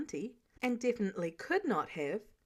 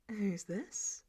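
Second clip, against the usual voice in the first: cool query; 7 dB quieter than usual. Acted. Natasha almost amused.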